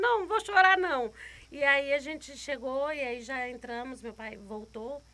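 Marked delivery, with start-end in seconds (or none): 0.0-1.1 loud, pushed-through promise not to cry; trying not to cry. Retold or imitated. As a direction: force the words out over tears. Mother fighting tears, loud.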